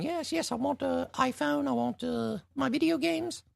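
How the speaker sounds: squeaky high mock British child voice; very high mock British accent; British kid accent. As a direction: ham up a posh British kid asking Santa for toys. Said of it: "exactly how British people really sound".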